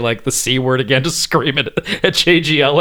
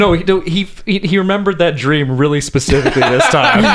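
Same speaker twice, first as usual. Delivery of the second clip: usual speaking voice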